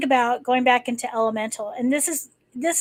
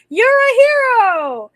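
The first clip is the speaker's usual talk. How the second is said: high, loud "you're a hero"; hammed-up grateful friends shouting praise; mock cheer; 12 semitones higher, 10 dB louder than usual. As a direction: cheer him on. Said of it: "and like".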